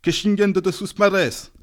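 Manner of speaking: high, loud, slow curse; angry crowd shout. A narrator's reading. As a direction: hurl the curse. Angry crowd hurling insults.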